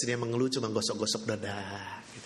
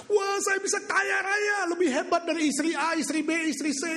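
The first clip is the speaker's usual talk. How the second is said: gleeful shout. Acted. Wife gloating she'll outshine other wives, high gleeful shout. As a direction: crow about getting rich.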